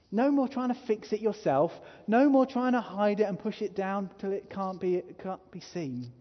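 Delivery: ordinary talk, nothing marked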